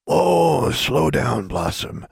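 a hint of a calming old man; lightly voiced old custodian. A narrator's reading. Custodian slows the frantic girl down, lightly voiced. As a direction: ease her down gently.

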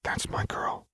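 tender whispered praise; soothing whisper. A narrator's reading. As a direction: breathe it softly to calm her.

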